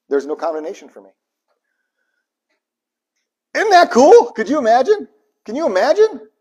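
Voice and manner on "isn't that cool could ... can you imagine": much higher and louder, thrilled; excited. Real, not acted. Preacher marveling to the congregation, thrilled and loud. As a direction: burst out in wonder.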